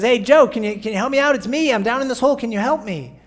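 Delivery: man in a hole shouts up to his friend, loud; shouting up. Retold or imitated. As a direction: holler up to Joe from the hole. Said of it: "the man shouts up".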